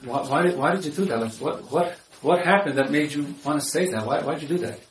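soft, gentle, sincerely curious asking; curious question. Shown as a demonstration. Husband asking his wife what happened, softly curious. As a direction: ask softly because you truly want to know. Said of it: "The second one is".

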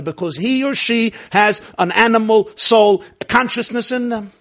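full-voiced rabbi's sermon; preaching. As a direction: preach it out to the room with force. Preaching to class about doubts.

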